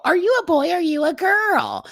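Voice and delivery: high-pitched put-on gawker voice; mock questioning onlookers. People asking about little boys in dresses, mocking high voice. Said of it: "So people go".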